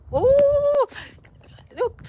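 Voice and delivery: high thrilled squeal; excited squeal. Real, not acted. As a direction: squeal with delight in the snow. Playing in the snow.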